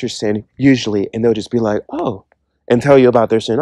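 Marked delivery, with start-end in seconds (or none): 1.9-2.2 mock surprise. Church member hearing a confession.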